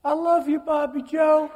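high, loud put-on voice calling out; squealed loud mock tribute; mock sentimental shout. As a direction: ham up a gushing declaration, pitched high. Mocking someone's sentimental cry.

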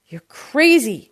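slow, loud, high outburst; shouting. A narrator's reading. Uncle answers produce man's insult to pomegranates, shouting. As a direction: shout it in outrage, drawn out. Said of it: "my uncle shouted".

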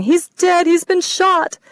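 high, loud sobbing words. Acted. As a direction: cry it out again. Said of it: "She started sobbing again".

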